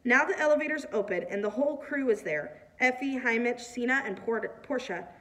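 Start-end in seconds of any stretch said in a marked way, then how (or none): none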